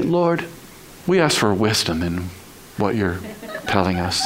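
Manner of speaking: low, softening mock-pious prayer; restrained polite prayer. Believer covering up the complaint to God, politely subdued. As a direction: drop into a careful, subdued prayer. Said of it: "But we say".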